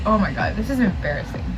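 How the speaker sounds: ordinary delivery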